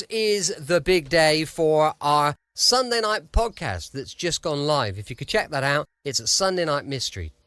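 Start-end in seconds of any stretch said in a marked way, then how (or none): none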